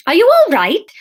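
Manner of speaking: high, loud alarmed question; a startled friend calling down to her. Acted. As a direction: rush to check on her. Fiona finds Emma sprawled.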